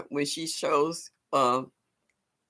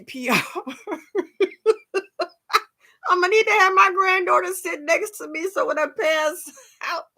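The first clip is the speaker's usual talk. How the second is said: laughing; 13 semitones higher, 4 dB louder than usual. Real, not acted. Laughing at own joke.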